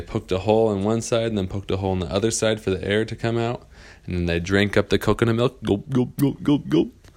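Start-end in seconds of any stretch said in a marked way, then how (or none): 5.6-7.1 rising playful gulp noises; gulping sounds. Acted. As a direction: imitate greedy drinking. Kids drinking coconut milk.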